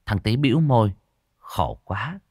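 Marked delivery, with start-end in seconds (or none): none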